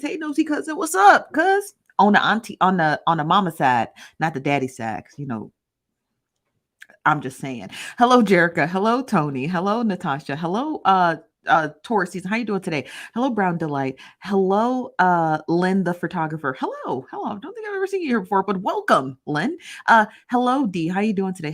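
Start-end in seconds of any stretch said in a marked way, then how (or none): 0.0-1.7 high, bright put-on voice, much louder on the last words; reading a chat comment in a high voice. Host reads viewer's chat greeting in high voice. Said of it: "She says". 16.6-18.4 high, quick surprised hello; surprised greeting. Real, not acted. Host surprised to see a new viewer in chat.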